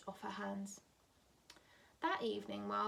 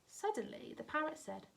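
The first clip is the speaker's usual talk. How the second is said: ordinary delivery, nothing marked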